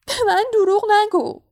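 high, loud wailing accusation; tearful, breathless. A narrator's reading. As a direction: wail it, choked with tears. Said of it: "نفسش بند آمده بود و حالا دیگر اشکهایش".

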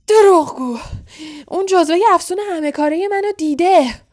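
very loud, high-pitched angry shout; snorting outrage. A narrator's reading. Caretaker calling the boy a liar, furious shout. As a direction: explode with a furious accusation. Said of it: "صدای خورناس مانندی درآورد".

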